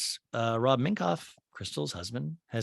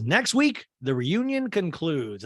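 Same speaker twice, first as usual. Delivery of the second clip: high, ringing mock TV teaser; pitched-up, slowing at the end; announcing; 5 semitones higher, slower than usual. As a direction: announce it like a show host. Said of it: "Andy announces".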